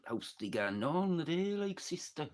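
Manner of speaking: put-on high-pitched, lilting old Melkridge village dialect. Villager greeting a neighbour in Melkridge, high old local dialect. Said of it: "proper old fucking language".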